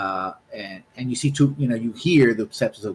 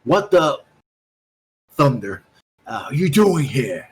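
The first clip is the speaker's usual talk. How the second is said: higher, louder shout of an angry man; angry male voice; 3 semitones higher, 5 dB louder than usual. Acted. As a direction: bark it at the intruder. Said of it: "you hear a male voice".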